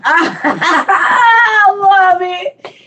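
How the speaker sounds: high, breathless laughter through the words; can't stop laughing at the joke; squealing with laughter; laughing hard. Real, not acted. Cracking up at a comment.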